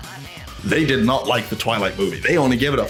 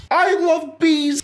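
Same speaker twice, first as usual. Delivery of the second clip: much higher, drawn-out gushing; mock enthusiasm; slower than usual. YouTuber feigning delight at a B score, mock gushing. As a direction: squeal it with put-on delight.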